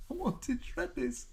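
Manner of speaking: high, drawn-out mock wail; mock crying. Tearful sketch character at the cafe table, mock crying. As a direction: play a weepy complaint, very high. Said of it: "She's crying at the table".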